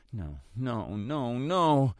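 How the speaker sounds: a frightened man whimpering at the mirror. Acted. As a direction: whimper it, shaking your head.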